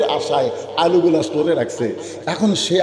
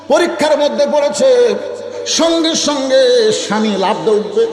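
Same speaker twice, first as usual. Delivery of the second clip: melodic preaching; 7 semitones higher than usual. Real, not acted. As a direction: intone it to the crowd, stretching the line endings. (সুরে সুরে টানা ওয়াজ)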